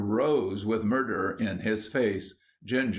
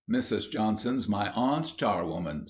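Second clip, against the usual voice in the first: ordinary delivery, nothing marked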